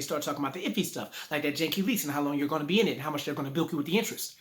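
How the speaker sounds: rapid rattling delivery; talking fast. Shown as a demonstration. Teacher mocking salesmen rushing the iffy terms, fast. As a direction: rush through the fine print. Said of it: "start talking fast".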